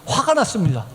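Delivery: ordinary talk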